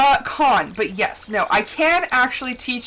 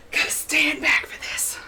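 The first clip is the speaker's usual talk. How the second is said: whisper; 4 semitones higher than usual. Real, not acted. Streamer whispers to herself before calling cattle. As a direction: whisper it to yourself. Stepping back before a loud call.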